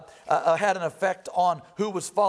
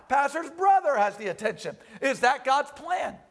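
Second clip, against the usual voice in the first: normal talking voice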